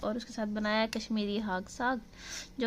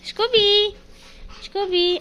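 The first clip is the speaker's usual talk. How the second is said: high, loud call to the dog; calling a puppy by name; 11 semitones higher, 7 dB louder, slower than usual. Real, not acted.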